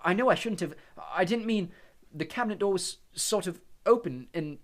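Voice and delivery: lightly voiced anxious boy; flustered Harry. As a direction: babble excuses to the headmaster. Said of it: "Harry gasped".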